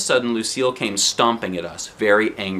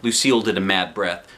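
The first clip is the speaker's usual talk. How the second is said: plain reading voice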